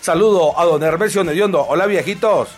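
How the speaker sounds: loud, booming radio host greeting; radio announcer. Acted. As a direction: hype up the listeners like an AM radio announcer.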